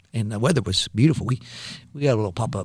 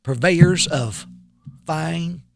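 ad read voice; 6 semitones higher, slower than usual. Acted. Reads the sponsor slogan.

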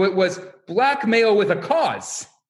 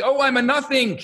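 higher-pitched imitation of a wailing student; hammed-up cry of self-abasement; mock wail; 6 semitones higher than usual. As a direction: lament it high, in mock anguish. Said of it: "screaming on either end of the study hall".

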